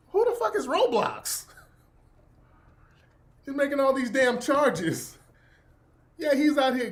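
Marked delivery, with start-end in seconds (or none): 0.1-1.5 outraged. Retold or imitated. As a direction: demand to know who this is. Retold outrage at charges.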